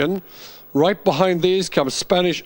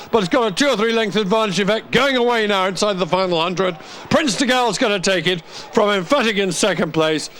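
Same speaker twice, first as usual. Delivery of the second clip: racing commentator lifting to a finish; rising finish call; 5 semitones higher than usual. Real, not acted. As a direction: pitch climbing higher as the winner pulls clear. Race finish, commentator building up.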